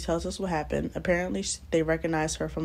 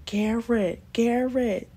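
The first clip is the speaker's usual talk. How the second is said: retold screaming; 7 semitones higher, 4 dB louder, much slower than usual. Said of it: "I'm screaming".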